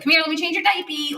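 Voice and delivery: lightly imitated coaxing talk to a little boy; Brad babying the dog. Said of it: "like he's a little boy".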